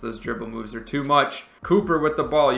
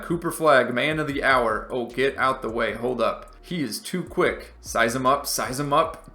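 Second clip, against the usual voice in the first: ordinary talk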